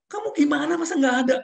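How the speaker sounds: high, loud incredulous scolding; angry boss. Retold or imitated. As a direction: snap back in disbelief. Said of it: "Marah-marah saya".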